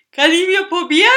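loud, high slurred question; boozy, smeared words; drunken slur. A narrator's reading. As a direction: ask it tipsily, far too loud. Drunk man asks for a taste.

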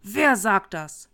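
put-on slow, loud drunken roar; drawn-out shouted demand; drunken bellow. A narrator's reading. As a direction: bellow it out slowly like a belligerent drunk. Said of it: "Sichtlich betrunken brüllte der Typ".

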